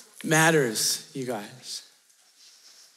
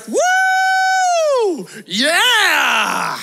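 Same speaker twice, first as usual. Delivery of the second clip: high, loud whoop of excitement; pumped screaming; 6 dB louder than usual. Retold or imitated. Preacher imitates excited stranger outside Burger King, pumped screaming. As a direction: holler it out, thrilled and pumped. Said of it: "somebody who is pumped. Like, they are excited".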